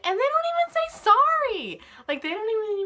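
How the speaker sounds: high, loud exasperated shout; shouted complaint, voice way up; indignant outburst. Real, not acted. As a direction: shoot up in pitch and volume. Ranting about friends who interrupt.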